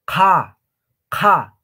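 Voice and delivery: QH demonstrated as a loud, harsh breathy KA; pokazowe „qh”. Shown as a demonstration. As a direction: burst KA out from the back of the mouth with air. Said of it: "Ahora, Q H".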